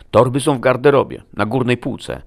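loud, high-pitched whine; groaning. A narrator's reading. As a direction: give in with a pained moan in the voice. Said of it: "jęknął Merit". (jękliwie, wysokim głosem)

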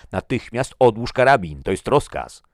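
loud, high military order; forceful officer's ultimatum; shouted command. A narrator's reading. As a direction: demand instant obedience at full voice. Armed standoff, officer orders gun down.

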